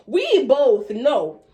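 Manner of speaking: "we both know" slow and loud; pointed emphasis. Retold or imitated. Teller confronts him over missed Mexican food, "we both know" slow and loud. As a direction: stretch "we both know", hammering each word. Pointed accusation in retold grievance.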